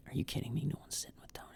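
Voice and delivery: hushed, stunned whisper; mock-shocked hushed aside; incredulous whisper. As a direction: whisper it in disbelief. Incredulous whisper about Tony alone.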